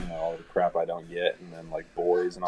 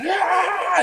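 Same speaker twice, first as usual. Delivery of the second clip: high mock battle cry; toddler attack yell; 5 dB louder than usual. Dad imitating daughter attacking him, high battle cry. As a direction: shriek like a tiny savage. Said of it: "she's going".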